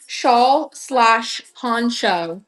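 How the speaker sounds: SHAWL, SLASH, PONCHO very slowly, one word at a time; careful enunciation. Real, not acted. Practising a tongue twister for viewers, "Shawl slash poncho" enunciated very slowly. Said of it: "really enunciate my words".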